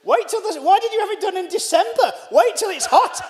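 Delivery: high, loud mock exasperation; incredulous outburst. Guest reacting to host's unflattering portrait, incredulous outburst. Comic mock advice to the painting's subject.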